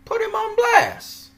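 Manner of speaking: very high, slow call to put him on blast; rally the viewers, pitch way up; high rallying cry. Real, not acted. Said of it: "if you guys are in his group".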